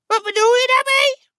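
shrill, high grumpy hag; nasty old woman. A narrator's reading. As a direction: snap it back like a spiteful old crone. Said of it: "met een nijdige blik".

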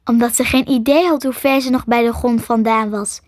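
ordinary delivery

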